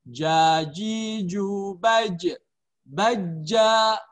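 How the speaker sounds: "Jajiju baj." drawn out very slowly, syllable by syllable; slow drilled syllables. Shown as a demonstration. Teacher models the jim drill slowly for students. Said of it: "Kemudian dha".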